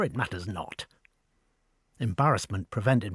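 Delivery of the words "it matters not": softer, lower, breezy aside; a hint of grand indifference; airy dismissal. A narrator's reading. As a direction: wave it away.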